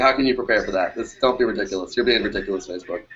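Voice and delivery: usual speaking voice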